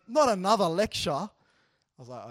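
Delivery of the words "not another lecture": loud mock teenage protest; imitated exasperation. Teacher voicing a student tired of advice, mock exasperation. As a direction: imitate a student switching off, loudly fed up. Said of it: "she turns off and she goes".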